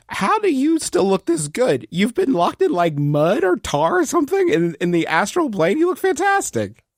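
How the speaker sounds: high, delighted gushing; gushing admiration. Acted. Taco admires Kravitz after their reunion, gushing. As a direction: marvel at them, pitch leaping on the compliment.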